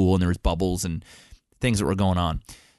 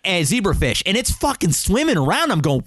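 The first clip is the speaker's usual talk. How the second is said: normal talking voice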